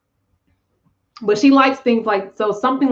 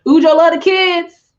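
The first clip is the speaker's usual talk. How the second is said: high, loud cutesy voice; playful funny voice; 8 semitones higher, 6 dB louder than usual. Pretend. Medium joking to viewers about spirit babies, silly high voice.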